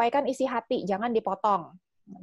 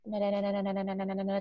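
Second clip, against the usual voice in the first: droning on one low note, softly; flat monotone; 3 semitones lower, 8 dB quieter, much faster than usual. Shown as a demonstration. Trainer showing how not to present to sanguines, flat monotone. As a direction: rattle it off without any intonation. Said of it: "jangan flat".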